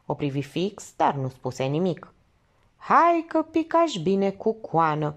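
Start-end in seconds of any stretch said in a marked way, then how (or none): 2.8-5.1 high, drawn-out mocking cheer; slow sarcasm in a raised voice; sarcastic exclamation. A narrator's reading. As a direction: jeer it slowly, pitched up. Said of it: "exclamă sarcastic farmacistul Popescu".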